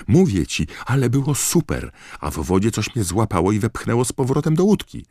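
put-on a little boy tumbling over his words; excited kid. A narrator's reading. Dennis gushing to Colin about the lake.

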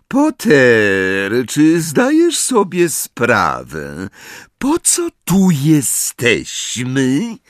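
lightly voiced menace, stretching the words slowly; menacing. A narrator's reading. Snape confronts Harry about his lessons, slow and threatening. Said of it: "zapytał Snape cichym, groźnym głosem".

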